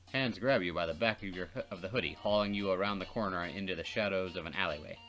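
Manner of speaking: ordinary talk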